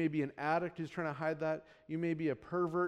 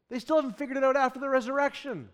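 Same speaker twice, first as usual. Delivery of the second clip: ordinary talk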